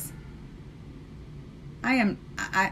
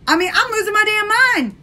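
much higher, louder frazzled outburst; mock exasperation; 10 semitones higher, 5 dB louder than usual. Scrolling five hundred comments, high exasperated outburst. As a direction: act overwhelmed by endless comments. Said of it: "I'm sitting there like".

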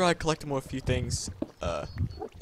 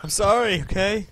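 loud, higher protest; raised-voice, exasperated sorry; defensive apology; 6 semitones higher, 8 dB louder than usual. Real, not acted. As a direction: snap back an apology. Defensive after being shamed for cheating.

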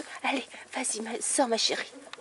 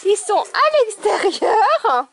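excited exclamation; 9 semitones higher, 5 dB louder, much slower than usual. Real, not acted. Excited about puppies outside.

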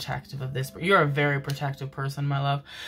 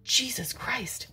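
breathless stunned whisper, high; real whisper; 6 semitones higher, 6 dB quieter than usual. Real, not acted. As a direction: whisper it, still shaken. Recovering from the mosquito shock.

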